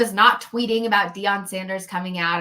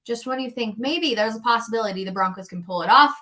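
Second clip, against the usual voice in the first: usual speaking voice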